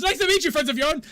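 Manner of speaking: high, loud, fast rattled-off greeting; the adrenaline-struck wizard greets the party again, high, loud and fast; wired greeting. Acted. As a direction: fire it off too fast. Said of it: "he goes".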